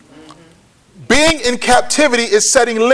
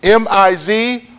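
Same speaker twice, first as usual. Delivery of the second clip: slow letter-by-letter spelling; measured letters with small pauses between groups; slower than usual. Shown as a demonstration. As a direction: spell it slowly for note-takers. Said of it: "It is spelled".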